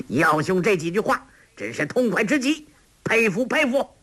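high, ringing praise after a laugh; 朗声赞叹. A narrator's reading. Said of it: "朗声道". (高声朗朗地喝彩)

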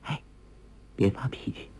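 gentle hushed voice close to the ear; soft whisper. A narrator's reading. Girl calming her angry friend by his ear, soft whisper. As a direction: whisper it softly to calm someone. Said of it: "一个柔软的声音在耳边轻声说".

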